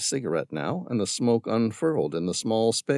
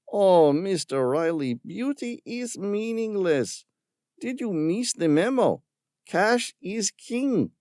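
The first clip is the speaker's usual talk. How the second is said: mocking villain; 13 semitones higher than usual. A narrator's reading. Villain scorning Tom's concern for the creatures. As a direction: sneer at the tree hugger. Ramirez voiced, contemptuous.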